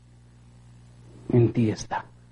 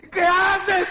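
high, shouted plea; desperate cry. Retold or imitated. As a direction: cry out in horror. (grito desesperado)

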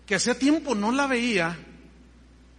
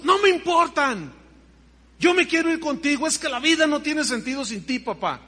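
desperate plea; 6 semitones higher, 5 dB louder than usual. Retold or imitated. Daughter begging her father in the vision, high and pleading. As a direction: insist she wants to go with him.

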